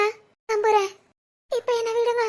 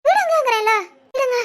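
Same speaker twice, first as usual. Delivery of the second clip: loud, upset command; exasperated insistence; 7 dB louder than usual. Acted. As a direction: insist forcefully on being let go. Wife insists he let go.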